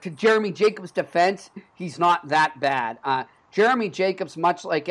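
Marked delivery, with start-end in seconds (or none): none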